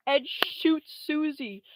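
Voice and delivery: dramatic slow exclaim. Acted. Telling the friend of the shooting, loud slow. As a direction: land the shot with big drama.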